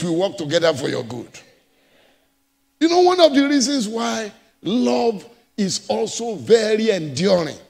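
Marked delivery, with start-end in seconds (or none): none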